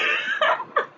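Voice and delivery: a real squeaky laugh; high giggle. Real, not acted. Vlogger still cracking up about the slip, giggling. Laughing at her own story.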